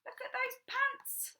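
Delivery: ordinary delivery, nothing marked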